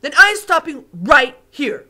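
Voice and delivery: loud, curt giant voice; offended giant refusing to go on; sharp. Acted. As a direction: snap it off sharply. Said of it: "said the B F G sharply".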